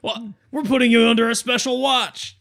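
high, loud guard voice; prison guard. Acted. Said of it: "a guard walks by and is like".

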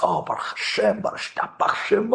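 whispered thanks, hushed and relieved; breathy relief. Retold or imitated. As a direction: breathe out thanks to God, barely voiced. Relief at getting last car.

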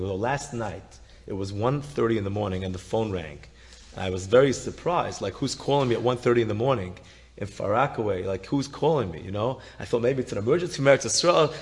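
normal talking voice

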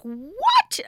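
high, loud "What?"; stunned exclamation rising sharply; shocked disbelief. Retold or imitated. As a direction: blurt it out in shock, pitch shooting up. Shocked reaction to fire news.

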